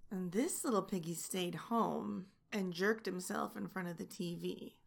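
put-on soft, sing-song rhyme that turns the children's verse into a taunt; teasing nursery rhyme. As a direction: recite it like a bedtime rhyme with a smirk.

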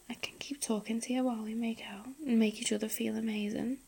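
soft intimate whisper; breathy close-mic whisper. Acted. Whispered intimate roleplay throughout.

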